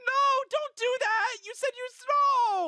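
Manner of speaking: high, loud, laughing no; squealing protest. Real, not acted. Streamer pleading at the video, squealing. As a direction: shriek no at the screen. Begging him not to.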